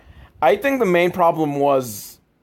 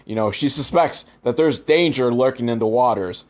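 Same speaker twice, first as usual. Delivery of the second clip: ordinary delivery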